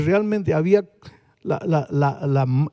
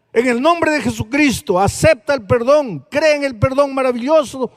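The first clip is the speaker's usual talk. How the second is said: louder and higher, fervent pulpit preaching; preaching at full force; 8 semitones higher, 5 dB louder than usual. Acted. Preacher urging a guilt-ridden listener to accept forgiveness, fervent and loud. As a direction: press every question home like a revival preacher.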